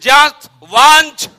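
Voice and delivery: slow, high, very loud proclamation; drawn-out preacher's shout; thundering scripture. Acted. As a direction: belt out each word of the verse. Preacher thunders back the read verse.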